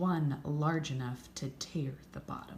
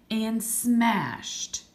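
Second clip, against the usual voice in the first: loud; 7 semitones higher, 9 dB louder than usual. A narrator's reading. Battle climax in story.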